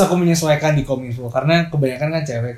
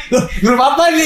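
panicked; 10 semitones higher than usual. Retold or imitated. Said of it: "Kayak pasti panik".

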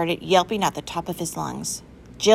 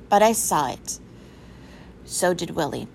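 usual speaking voice

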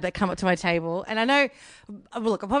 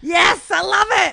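high loud slow cheer; excited shout; 11 semitones higher, 7 dB louder than usual. Real, not acted. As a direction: cheer it out, thrilled.

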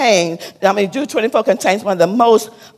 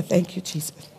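hushed thanks; 10 dB quieter than usual. Real, not acted. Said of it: "My heart is just hurt".

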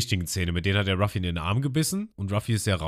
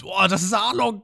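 high, louder voice of sudden realization; awed Usopp; 12 semitones higher, 5 dB louder than usual. Retold or imitated. Usopp tells the crew it's Arlong, high and amazed. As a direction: announce it like the discovery of the millennium. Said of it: "hat so die Erkenntnis des Jahrtausends und meint".

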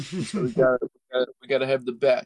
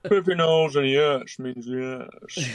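Sean Connery impression; slower than usual.

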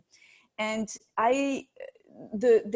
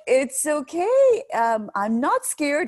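higher, louder, slow forced calm; pretending calm; 6 semitones higher, 6 dB louder, much slower than usual. Mother retelling how she faked calm to her toddler, higher and slow. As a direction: fake being brave, drawn out. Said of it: "Here I am pretending, completely pretending".

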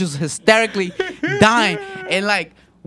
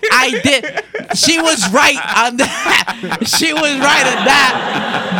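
higher, slowed-down, laughing confession; laughing admission; 4 semitones higher, 3 dB louder, slower than usual. Real, not acted. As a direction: concede it through real laughter. Teased teller concedes while laughing.

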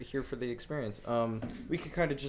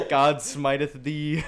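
mock biblical decree; 3 semitones higher, 8 dB louder, much slower than usual.

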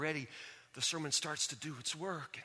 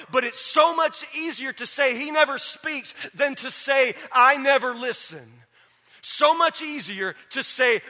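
loud, high, hammering exhortation; full-force preaching; 8 semitones higher, 16 dB louder than usual. Real, not acted. Preacher tells those who deny God speaks they're lying, full-force preaching. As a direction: confront the congregation, punching the key words.

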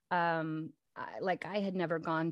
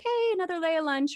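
mock cheerful quote; 12 semitones higher, 6 dB louder than usual. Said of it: "we'll be like".

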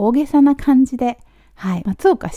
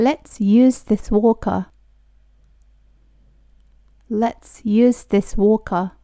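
slow, careful teacher pronunciation; slow model reading; much slower than usual. Shown as a demonstration. Teacher reading slowly for students to repeat. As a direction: spell each word out slowly for learners. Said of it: "私と一緒に発音してもらったら".

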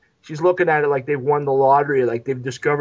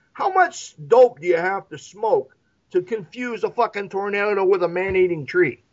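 ordinary delivery

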